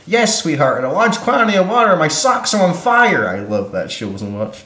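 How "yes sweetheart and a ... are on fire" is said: mock shouting voice. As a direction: ham up the shouted line. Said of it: "And she's like".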